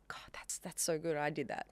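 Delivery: very soft, breathy whispered marvelling; hushed self-praise. Retold or imitated. Songwriter alone, amazed at what she made, hushed self-praise. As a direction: whisper it to yourself in awe. Quiet awe at own work.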